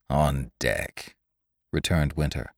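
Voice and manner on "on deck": lower, full-throated growl; seaman's growl. A narrator's reading. Winter answering the lady with a low growl. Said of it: "the full-throated growl of a seaman".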